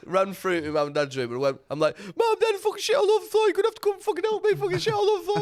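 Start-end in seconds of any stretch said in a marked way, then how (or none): 2.0-5.4 high, frantic yell for help; panicked yelling. Retold or imitated. Drunk son yelling to his parents about the mess, high panicked shout. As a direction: shout for mum and dad in a panic.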